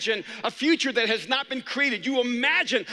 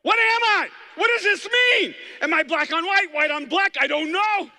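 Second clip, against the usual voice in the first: high shouted existential zebra cry; a zebra panicking about its stripes; anguished zebra; 9 semitones higher, 6 dB louder than usual. Pretend. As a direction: shout out a zebra's identity crisis. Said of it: "zebra out there going".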